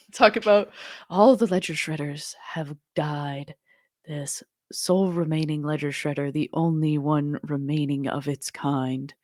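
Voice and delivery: hushed whispering wildlife narrator; nature documentary. Pretend. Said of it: "my, uh, nature documentary voice".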